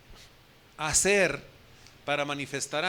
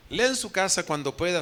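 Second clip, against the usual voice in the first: usual speaking voice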